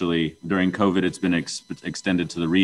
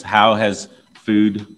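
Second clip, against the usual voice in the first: repeating for the mic; 2 semitones higher, 7 dB louder than usual. Real, not acted. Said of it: "to make sure the microphone got that".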